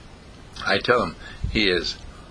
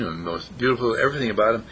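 ordinary delivery, nothing marked

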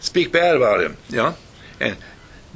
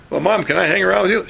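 put-on quick, higher-pitched child's request; eager little boy; 6 semitones higher, faster than usual. Son asking mom to hang around, acted eagerness.

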